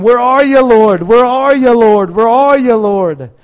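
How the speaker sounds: high, loud, pleading call to the Lord; desperate crying out. Retold or imitated. Believers lost and searching call loudly for the Lord. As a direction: cry out to God like you're lost. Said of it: "like, going".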